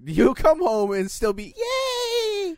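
squealed high, laughing mock yay; mock cheer. Mocking his wife's Christmas cheer, squealed yay. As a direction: send up her holiday glee with a shrill yay.

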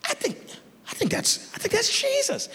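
very high, hushed voice; hesitant squeak as if peering into the distance; straining, unsure disciple. Acted. Disciples straining to see Jesus.

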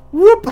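a sudden very high, loud whoop; startled whoop. Retold or imitated. Startled as the camper suddenly lands, high whoop. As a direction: yelp it out like he just appeared.

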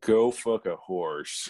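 slow, drawn-out imitation of a comedian's delivery; Brad Jones. Co-host redoing the quote in his voice, slow mock impression. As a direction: put on his voice, taking each word slowly. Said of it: "you'd have to say in his voice".

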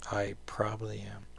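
soft, whispery self-admission; quiet aside. Real, not acted. Host admitting it to himself, hushed aside. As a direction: trail it under your breath.

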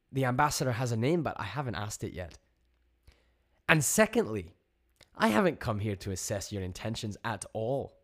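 loud, high-pitched telling-off; indignant. A narrator's reading. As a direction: set them straight, indignant and emphatic. Joni angrily confronts the alien voice.

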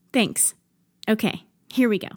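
ordinary talk, nothing marked

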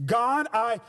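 slow, lifted preaching prayer, each line weighed; earnest pulpit prayer, drawn out; surrender prayer. Acted. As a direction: pray it out as giving up control.

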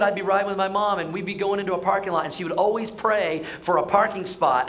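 usual speaking voice

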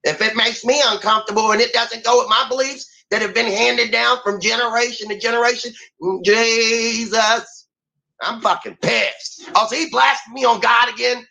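high-pitched mocking complaint; whiny grown-up kid. Mimicking grown-ups whining about new technology, high mocking voice. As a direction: play an offended older grown-up throwing a childish fit. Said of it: "acting like the fucking grown-ass kid".

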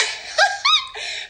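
very high, silly cackle; goofy laugh. Acted. Fan tickled by McFly, high goofy laugh.

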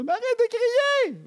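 very high, loud, drawn-out mock shriek; teen yelling. Teen yells at parent to stop yelling, mock shriek. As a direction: imitate a teenager screeching in protest. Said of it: "les autres, ils crient".

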